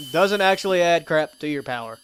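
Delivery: usual speaking voice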